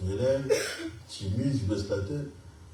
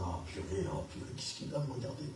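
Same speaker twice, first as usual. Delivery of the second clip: whispered oh purée under his breath; hushing his own panic in church; hushed panic; 5 semitones lower, 13 dB quieter than usual. Retold or imitated. To himself as the prophet nears, whispered worry.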